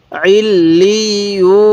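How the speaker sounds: "আইল লি ইউ" slow, doubled l and long final oo; doubled letters. Shown as a demonstration. Teacher showing learners how to read joined letters, slow drawn-out syllables. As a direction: sound out "আইল লি ইউ", stretching each joined syllable. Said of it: "কীভাবে পড়ব?".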